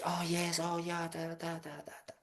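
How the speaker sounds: very quiet, imitated small talk; soft agreeable chatter. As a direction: hush it into murmured, nodding-along filler. Retold chat with French cook.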